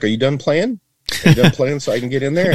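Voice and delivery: high, quick mocking jab; teasing dad. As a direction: needle the kid, fast and high. Dad teasing son at repair.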